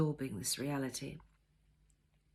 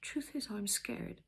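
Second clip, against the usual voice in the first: softer, slow, barely voiced; almost soundless; 5 semitones higher, 6 dB quieter, slower than usual. A narrator's reading. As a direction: hardly let it out. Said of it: "almost soundlessly said".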